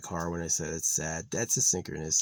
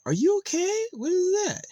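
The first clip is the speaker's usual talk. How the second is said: much higher and louder, cooing at the bird; 9 dB louder than usual. Real, not acted. Streamer calling to the odd bird in a high coo. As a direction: ask the bird like a worried pet owner.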